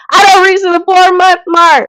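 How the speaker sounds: high, loud celebratory shout; mock celebration. Vlogger mocking the celebration she skipped, with a high loud cheer. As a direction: cheer it like a milestone party. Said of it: "instead of me celebrating and saying".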